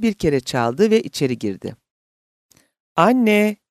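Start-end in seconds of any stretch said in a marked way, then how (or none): 3.0-3.6 loud, higher-pitched call into the house. A narrator's reading. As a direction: call out for mom. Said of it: "diye seslendi". (seslenerek, yüksek sesle)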